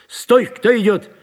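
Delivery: loud, high challenge; sentry's shout. A narrator's reading. Sentry challenges someone approaching in the dark, shouting. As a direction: call out a sudden challenge. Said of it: "оглушающе крикнул часовой".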